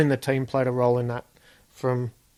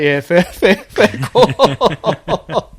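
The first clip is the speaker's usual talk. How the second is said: loud laughing concession, much louder; laughing agreement; 4 semitones higher, 9 dB louder, much slower than usual. Retold or imitated. To the commissioner telling him to pull over, loud laughing agreement.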